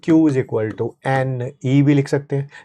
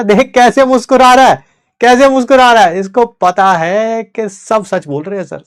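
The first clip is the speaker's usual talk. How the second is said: high, loud teasing call-out; playful mock accusation; 7 semitones higher, 7 dB louder than usual. Real, not acted. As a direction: tease the grinning student. Teacher teasing smiling student.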